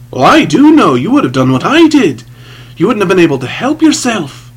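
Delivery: high, loud, insistent contradiction; heated retort. A narrator's reading. Sinner arguing with the priest, heated retort. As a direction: fling it back at him forcefully.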